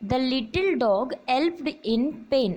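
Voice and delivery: ordinary delivery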